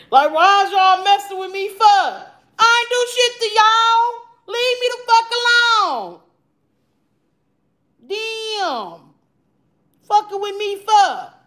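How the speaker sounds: high, sing-song whine; whiny sing-song complaint. Real, not acted. Whining at trolling commenters.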